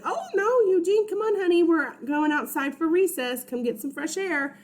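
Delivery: put-on high, sugary teacher calling the kids out; sweet teacher voice.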